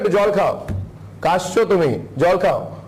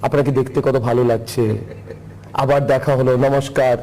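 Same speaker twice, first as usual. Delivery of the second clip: sugary, lower, fawning mock interviewer; meek sweet questions; 8 semitones lower than usual. Anchor mocking Bengal media's fawning questions, sugary mimicry. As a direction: simper like a timid flattering reporter. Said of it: "মিন, মিন করে".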